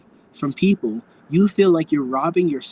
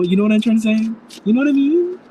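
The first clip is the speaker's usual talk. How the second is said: climbing high, loud squeaky rise; high-pitched check; 7 semitones higher, 5 dB louder, faster than usual. Real, not acted. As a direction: voice shoots up higher and louder as you ask. Doubting own words, playful check.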